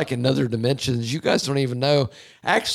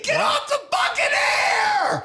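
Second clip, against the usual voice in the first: screaming; slower than usual. Pretend. Ranting at a harsh reviewer, high screaming. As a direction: scream it at the critic, mock fury. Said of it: "why are you screaming".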